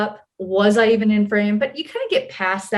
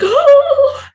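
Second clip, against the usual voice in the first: loud, high gasped "oh"; mock shocked gasp; 10 dB louder than usual. Imitating another actor spotting a star, shocked gasp. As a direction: act out a huge stunned gasp. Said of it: "her face is just like".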